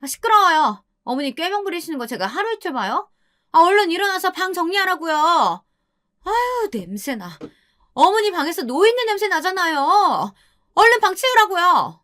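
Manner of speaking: high-pitched, sneering scolding; bully the old woman with contempt; wife berating. Acted. Wife abuses mother-in-law. (시어머니에게 높은 톤으로 퍼붓는 며느리의 독설)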